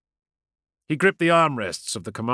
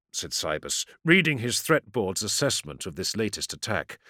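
ordinary delivery

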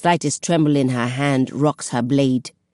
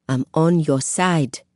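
plain reading voice

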